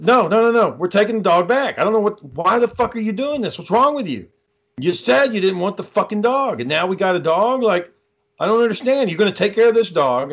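re-enacted rant, pitched well up; acting out an exasperated, high-pitched scolding; irate argument. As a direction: argue back in high, incredulous outrage. Acted quarrel over the dog.

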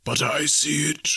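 high, slow spectral voice; ghost. A narrator's reading. Ghost correcting Scrooge, slow eerie insistence. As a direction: intone it slowly as a phantom.

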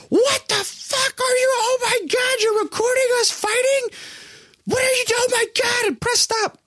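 high-pitched, frantic mock shriek; freaking-out parents. Parents catching their kid recording the fight, mock freak-out. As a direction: act out panicked parents in a very high screech. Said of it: "So they're freaking out".

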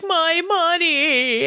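high, slow, drawn-out whine; a spoiled child wailing over his toy; whiny tantrum. Retold or imitated. Villain wailing as the dye pack ruins his cash, hammed-up tantrum. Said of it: "he throws an actual tantrum".

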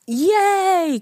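high, drawn-out celebratory yay; mock cheer. Imitating the man celebrating his gift card, high cheer. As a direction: cheer it high, as if throwing hands up.

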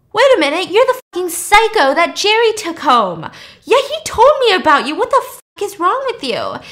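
high, loud mock outrage; outraged accusation. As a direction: act out a man loudly calling someone out. Suit man accuses her, acted out.

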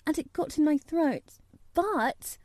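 put-on high-pitched prim little girl; high, severe child's reply; reproachful dignity. A narrator's reading. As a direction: answer with wounded, proper dignity. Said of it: "she replied with reproachful dignity".

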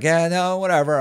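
high-pitched brush-off; dismissive waiter. Retold or imitated. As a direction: imitate a waiter waving it away.

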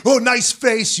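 a hint of mock friendliness; mocking impersonation. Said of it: "They start impersonating what happened".

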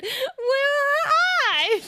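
very high, loud cat voice through laughter; snooty bored cat. Retold or imitated. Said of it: "the cat said".

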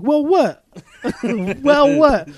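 panicked high yell of well, what; alarmed demand. Retold or imitated. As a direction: shout it in sudden panic. Alarmed at friend's hesitation.

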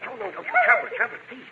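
high urgent plea. Acted. Dog barking at night.

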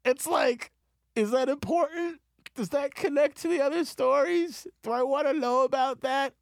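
getting hostile. Real, not acted. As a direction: rant in a shrill mock rage. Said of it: "I'm getting hostile".

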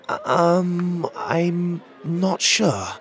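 high, halting, drawn-out uncertainty; small high voice, slow and hesitant; unsure young boy. A narrator's reading. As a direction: stretch it out slowly like an unsure child. Boy unsure of parents' names.

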